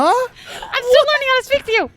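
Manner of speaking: high squirrel voice, half crying; a squirrel close to tears. Acted. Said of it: "the squirrel voice is too good".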